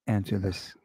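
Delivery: scared little whisper. Real, not acted. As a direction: whisper it as if spiders could hear. Arachnophobic, refusing the question.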